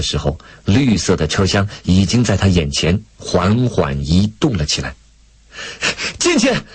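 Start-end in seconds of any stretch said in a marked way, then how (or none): 6.2-6.6 very high, frantic call. A narrator's reading. Lei Feng searching for someone on a departing train, frantic calling. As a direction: shout a name in desperate hurry. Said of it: "雷锋火急火燎地喊".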